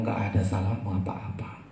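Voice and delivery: gentle whispered reassurance from the mother; mother eagle whispers to her chick, calming. Acted. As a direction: breathe it softly to the chick. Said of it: "Si induk Raja Wali akan bilang".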